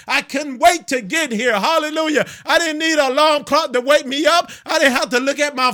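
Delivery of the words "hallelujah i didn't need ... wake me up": ordinary delivery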